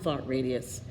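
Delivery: usual speaking voice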